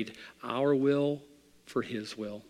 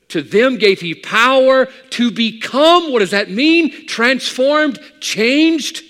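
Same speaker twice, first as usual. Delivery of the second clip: very high, loud pulpit shout; soaring preaching cry; 13 semitones higher, 15 dB louder, slower than usual. Acted. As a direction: lift the congregation with each word.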